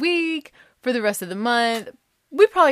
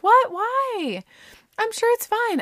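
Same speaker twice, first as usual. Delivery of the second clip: high-pitched imitation of puzzled friends; dismissive friends; slower than usual. Friends brushing off her worry, high mock reassurance. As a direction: squeak it like doubting pals.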